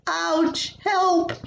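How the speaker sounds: sudden high yelps of pain and panic; high pained ouch then a panicked call for help; startled cry of pain. Retold or imitated. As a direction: cry out as the blade slices your finger. Cutting finger, cries out.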